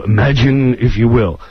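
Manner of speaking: low, measured TV host intro; Rod Serling. Retold or imitated. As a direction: imitate an ominous narrator opening. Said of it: "Norm is the guy who's gotta go".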